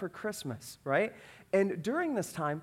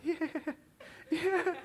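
imitated happy child; 10 semitones higher than usual. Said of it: "she's enjoying it".

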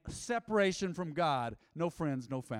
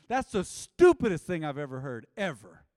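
much louder, punching every word; preached out loud; 10 dB louder than usual. Real, not acted. Pastor blasting a foolish claim before the church. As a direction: spit it out like a preacher going hard. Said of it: "I spit when I preach".

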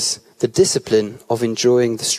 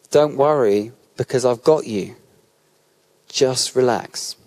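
reassuring; slower than usual. Acted. Voicing Jesus' reassurance.

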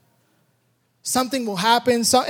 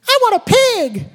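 put-on high little-girl voice begging for a pig; daughter's plea; 10 semitones higher than usual. Little daughter begging dad at the fair, high childlike plea. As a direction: voice the small daughter pleading, pitched way up.

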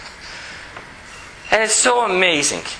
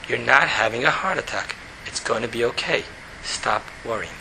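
much lower, soft and reassuring; soothing calm; 9 semitones lower, 7 dB quieter than usual. Acted. Calming Avrami's panicking mind, soft and reassuring. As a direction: settle him down gently.

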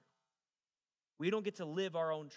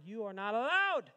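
high, loud mock proclamation; mock-stern refusal; 5 semitones higher, 6 dB louder than usual. Preacher retelling his refusal to an ex, mock-stern shout. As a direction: forbid it with hammed-up force.

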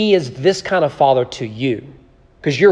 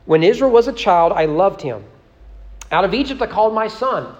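reading scripture aloud with rising fervor; 5 semitones higher than usual. Acted. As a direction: give the prophet's words full, ringing weight. Reads Hosea aloud to congregation.

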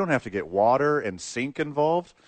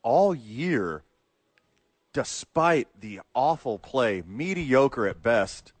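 slow emphatic; much slower than usual. Real, not acted. Arguing the quarterback point.

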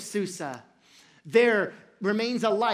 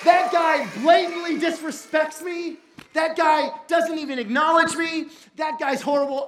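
loud, high, emphatic outrage at a rival; indignant villain rant; 6 semitones higher, 5 dB louder than usual. Acted. Villain venting to his wife about Mordecai, indignant. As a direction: rant indignantly, pitched up and punching every phrase.

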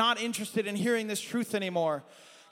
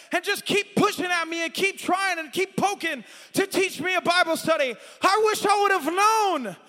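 voice of the lost pleading; 8 semitones higher, 5 dB louder than usual. Retold or imitated. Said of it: "I hear the cries of people burning, saying".

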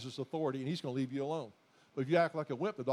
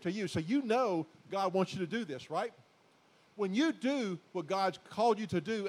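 normal talking voice

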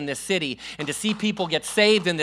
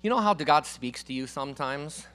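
ordinary delivery

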